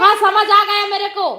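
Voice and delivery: loud, high, impatient retort; exasperated. Real, not acted. Mother cuts off the pestering child.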